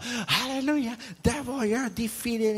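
high-pitched mock revival scream; imitating screaming Christians. Preacher mimics Christians in another church, mock screaming. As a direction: cry out in exaggerated, drawn-out triumph. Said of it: "saw or heard Christians screaming out".

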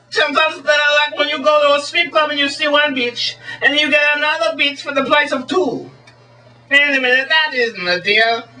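high, hammed-up mock Swedish showman; Swedish magician. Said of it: "as the Swedish magician".

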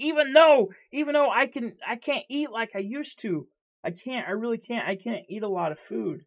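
high, strained, rising frustration; agitated outburst. Real, not acted. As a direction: blurt it out, upset. Heated outburst about eating.

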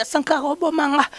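high-pitched mock pleading voice; imitated plea. Mimicking the man who begged, high and pleading. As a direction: squeak it out like the begging victim. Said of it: "ye mwa ebine na beta ye azosenga".

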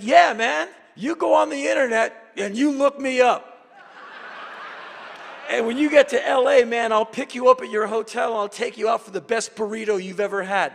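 high, loud eager invite; hyped promise. Retold or imitated. Promising the minder a burrito in LA, excited and loud. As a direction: sell him on LA with big enthusiasm. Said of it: "And I said".

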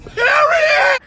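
emphatic shout. Acted. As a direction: shout it way up high and loud. Hyping the doll's name.

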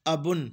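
अबुन with a short, cut-off first "a"; brief अबुन, first vowel at half length; short zabar. Shown as a demonstration. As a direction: snap straight from the "a" to "bun" in अबुन. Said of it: "आधी आवाज़ है ज़बर की".

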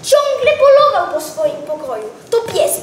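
usual speaking voice

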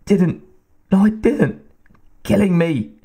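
high, strangled words forced out; choked, raspy pleading. A narrator's reading. Choking woman gasps out her denial to her attacker. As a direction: gasp it out while being strangled. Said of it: "her words choked and raspy".

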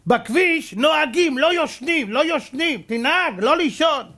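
high, loud and slow scolding; loud, high, slow sanctimonious driver; preachy driver lecture. Retold or imitated. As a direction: lecture the other driver from your window. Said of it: "בא איזה אחד".

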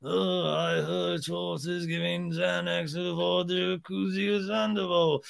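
slow, droning put-on voice; hammed-up slow impression; Ken. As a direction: draw every word out in a caricature.

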